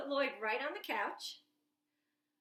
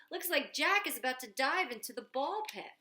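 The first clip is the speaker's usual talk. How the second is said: ordinary delivery, nothing marked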